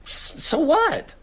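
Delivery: high, stammered disbelief; baffled, high-voiced protest; incredulous. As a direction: demand an explanation, pitch jumping up. Disbelieving friend hears a strange story.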